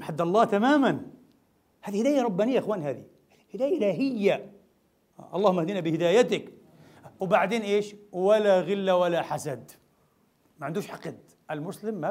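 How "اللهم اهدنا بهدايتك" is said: ordinary talk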